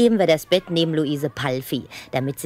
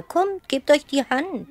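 slow, higher coaxing; 12 semitones higher, slower than usual. Acted. Headmistress coaxes the two girls, slow and higher. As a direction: gently urge them to shake hands.

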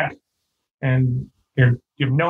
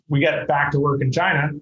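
normal talking voice

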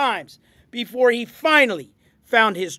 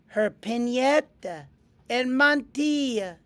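plain reading voice

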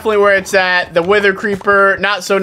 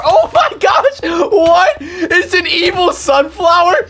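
yelp, then laugh it out; shocked laughing cry; 11 semitones higher than usual. Real, not acted. Gamer finds attacking sunflower, shocked laughing cry.

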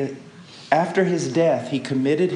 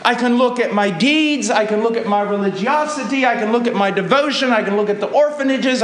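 loud, high impassioned preaching; rising pulpit fervour; preaching at full force; 10 semitones higher, 5 dB louder than usual. Acted. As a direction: drive each line home to the congregation.

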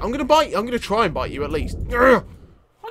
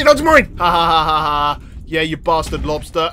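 ordinary talk, nothing marked

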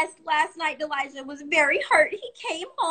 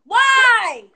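much higher and louder demand; angry outburst; 9 semitones higher, 10 dB louder, slower than usual. Real, not acted. Teen angrily confronts the adult on the live. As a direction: fire it back at her.

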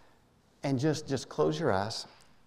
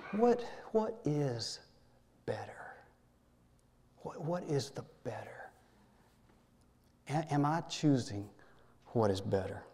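whispered question. Acted. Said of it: "voicing the reader's inner question".

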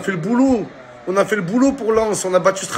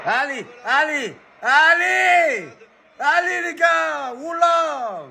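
excited cheering; 4 semitones higher, slower than usual. Real, not acted. Cheering on team.

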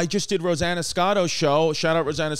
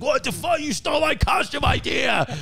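high, shouting outrage mimicking Joe; high mock-furious shout; imitated angry shout; 9 semitones higher than usual. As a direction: squeal it out like a furious friend. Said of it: "genuinely mad".

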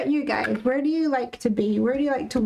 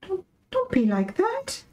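1 pleading; 10 semitones higher than usual. Real, not acted. Pleading with machine.

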